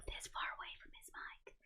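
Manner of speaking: whispered, as if muttering far from the mic. Retold or imitated. Chatter mimics a streamer drifting from his mic, whispered. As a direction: fade to a breathy whisper, barely there. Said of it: "he totally started muttering".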